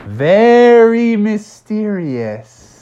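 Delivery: mysterious. Acted. The family pondering Santa, slow mysterious voice. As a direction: stretch it out ominously.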